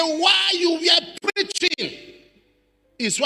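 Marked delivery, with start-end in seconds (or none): 0.0-2.0 high, slow mock wail; wailing man's words. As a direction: retell his cry with a quaver.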